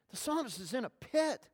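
ordinary talk, nothing marked

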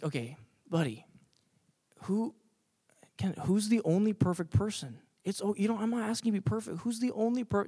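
soft, near-whispered counsel; gentle coach. Acted. Church elder coach to crying young pitcher, hushed and gentle.

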